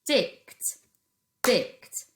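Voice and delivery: Dict said loudly and sharply; teacher isolates the second syllable; stressed syllable. Shown as a demonstration. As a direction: punch Dict as its own syllable. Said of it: "is the second part of that word".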